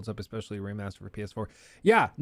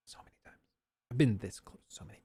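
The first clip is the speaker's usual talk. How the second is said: a breathless whispered confession; whispered aside; faster than usual. Real, not acted. Streamer confessing urge to delete Twitter, whispered. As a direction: drop to a whisper as if admitting a secret.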